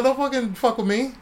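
high-pitched, comic telling-off; mock warning. Artist warning a supposed prank caller, mock indignant.

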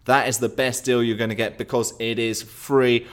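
ordinary talk, nothing marked

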